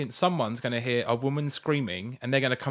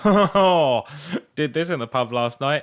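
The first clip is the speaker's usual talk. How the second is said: mock drunk younger self; 3 semitones higher, 6 dB louder, slower than usual. Mocking old Facebook post.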